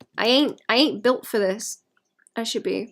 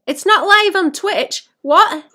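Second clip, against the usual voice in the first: higher, louder, slow shocked outburst; alarmed disbelief; 9 semitones higher, 10 dB louder, slower than usual. Real, not acted. Discovering the Twitch stream is down, shocked and loud. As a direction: realise it's broken.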